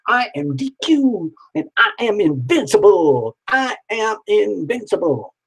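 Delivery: high, proud boast as the client; triumphant proclamation. Pretend. Speaking as client D Q, mock triumphant. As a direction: proclaim invincibility like a hero.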